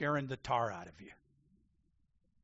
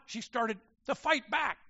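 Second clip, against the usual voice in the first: normal talking voice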